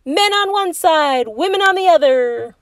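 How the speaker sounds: high, loud announcing call; rally the teams like a captain. A narrator's reading. Dad splitting the family into teams, calling out.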